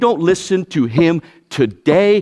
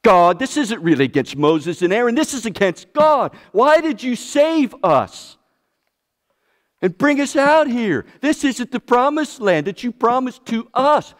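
higher pitched whining to God; mock whining complaint; 8 semitones higher than usual. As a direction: plead and whine like ungrateful people. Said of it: "In other words".

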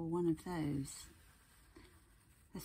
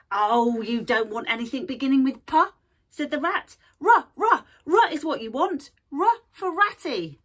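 high, loud, pushy shopkeeper rat; cheeky rat voice; 5 semitones higher, 11 dB louder than usual. Acted. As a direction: sell it like a brash little rat. Rat speaks in story.

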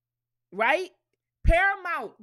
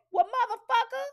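higher and louder sassy retort; 9 semitones higher, 4 dB louder than usual. Retold or imitated.

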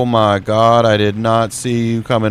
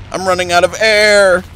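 higher, alarmed repeat; higher and tenser; rising alarm; 9 semitones higher, 4 dB louder than usual. Real, not acted. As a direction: say it again with alarm climbing. Same line repeated higher.

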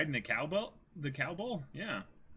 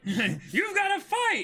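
shouted much higher, drawn out and slow, breaking into laughter; high shouted character voice; 6 dB louder, slower than usual. Acted. Reading Luffy to Usopp, a shouted, laughing high voice. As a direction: belt it out like a cartoon hero. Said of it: "How would I do Nami's voice and Luffy's voice".

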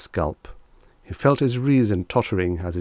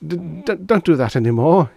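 high, stammering plea; pleading; 10 semitones higher, 7 dB louder than usual. A narrator's reading. Reggie begging after the vanishing act, rattled pleading. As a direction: stammer and beg, pitched up. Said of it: "he pleaded".

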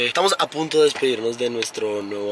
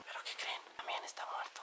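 breathy hush, sleeping friends nearby; whispering; 26 dB quieter than usual. Real, not acted. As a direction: whisper so nobody wakes. Friends asleep in hotel room.

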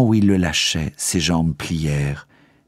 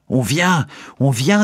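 urgent warning; 7 semitones higher, slower than usual. A narrator's reading. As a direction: warn them the guards are here. Jailer warns guards coming. (alerte pressante, aiguë et forte)

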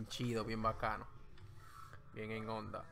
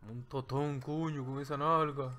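slow, louder comic flirting with a strange put-on voice; hammed-up pickup line; 4 dB louder than usual. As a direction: ham up a cheesy street come-on. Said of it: "Ay, tú tiras el pi-, el tiropo".

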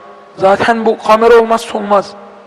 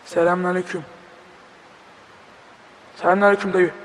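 ordinary talk

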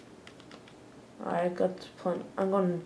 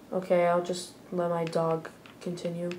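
ordinary delivery

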